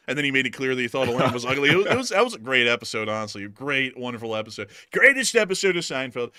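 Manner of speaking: ordinary delivery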